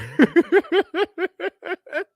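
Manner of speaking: a burst of high real laughter. Real, not acted. Laughing high while telling the officer he is done. Laughs at his own story.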